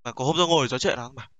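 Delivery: usual speaking voice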